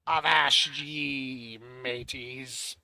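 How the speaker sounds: slow drawn-out pirate growl. Pretend. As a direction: stretch it out like an old sea dog. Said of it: "Talk like a pirate day".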